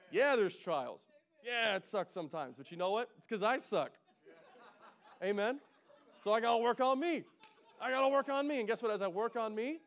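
fired-up preacher pushing the church to act; preaching at full force. Acted.

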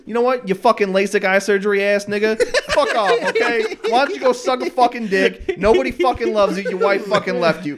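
laughing rant. Real, not acted. Ranting at a coworker who wronged him.

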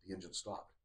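very quiet, low retold reaction; hushed realization. Retelling a man noticing the engine has stopped, hushed realization. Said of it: "he starts freaking out".

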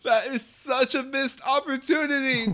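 high-pitched, wailing exclamation; squealed, delighted dismay; exclaiming. Real, not acted. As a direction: cry it out way up high. Mock outrage at listener's joke.

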